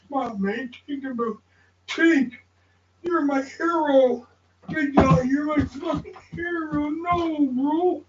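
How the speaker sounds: high, fired-up praise; excited cheering. Real, not acted. Cheering a friend as his hero, high and excited. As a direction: hype your friend up.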